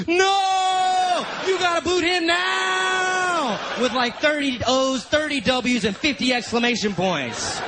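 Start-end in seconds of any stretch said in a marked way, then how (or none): 0.1-3.6 high, drawn-out yell; mock-furious all-caps outburst; all-caps shout. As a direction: stretch each final word into a hammed-up scream. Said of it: "writes in all caps".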